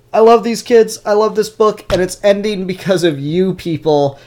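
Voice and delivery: loud, high mock outrage; playful angry rant at viewers. Real, not acted. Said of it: "I'm sorely disappointed".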